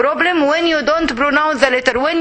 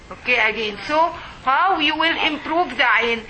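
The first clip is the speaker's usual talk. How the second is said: usual speaking voice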